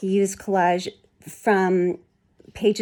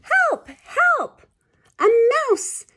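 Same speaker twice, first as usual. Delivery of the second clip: high, loud cries for help; frightened scream; 6 dB louder, slower than usual. Acted. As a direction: shriek it out in panic. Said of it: "There was a scream".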